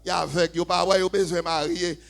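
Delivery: high, loud pulpit exhortation; full-force preaching. Real, not acted. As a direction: hammer it home to the congregation.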